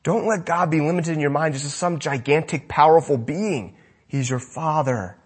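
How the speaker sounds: ordinary talk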